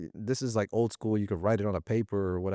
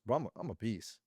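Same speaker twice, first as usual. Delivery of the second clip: cocky sales rep; 5 semitones higher than usual. Retold or imitated. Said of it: "Everybody goes into the office and what is".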